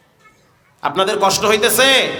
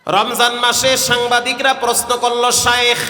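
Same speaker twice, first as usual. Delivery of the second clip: melodic waz preaching. Acted. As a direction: draw the question out in a preacher's tune. Tuneful sermon storytelling. (সুরে সুরে ওয়াজ)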